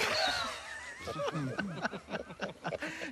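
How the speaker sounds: soft helpless laughing at own joke; tickled laughter. Real, not acted. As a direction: laugh quietly as you remember the punchline. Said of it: "nomás de acordarme me da risa".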